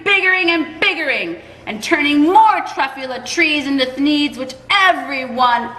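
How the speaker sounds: the Onceler shouting down the Lorax; yelling Onceler. Acted. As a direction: yell back at him, stubborn and defiant. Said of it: "I yelled at that Lorax".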